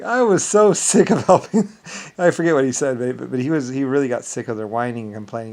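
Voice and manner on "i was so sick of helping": high, loud mock complaint; whiny rival imitation. Quoting the rival general griping about allies, mock whine. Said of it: "He was like".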